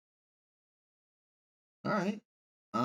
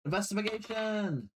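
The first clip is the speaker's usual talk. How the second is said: playful call-out; 10 semitones higher than usual. Pretend. Player echoing the game master's investigation check, playful call-out. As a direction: sing it out high with mock excitement.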